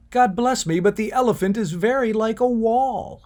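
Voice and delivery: high, loud exclamation; bawling. Acted. First blind man declaring his finding, theatrical bawl. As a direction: bawl it out as a hammed-up discovery. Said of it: "at once began to bawl".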